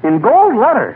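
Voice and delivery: pleased exclamation over the gold letters; excited. Acted. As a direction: admire it out loud, thrilled. Fibber admiring his name stamped in gold.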